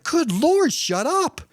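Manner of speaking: exasperated. Retold or imitated. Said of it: "I was like".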